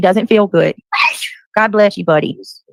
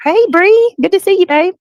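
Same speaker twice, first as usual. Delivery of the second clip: much higher, bright sing-song welcome; sweet greeting; 11 semitones higher, 3 dB louder than usual. Real, not acted. Host greeting a viewer in chat, high and sweet.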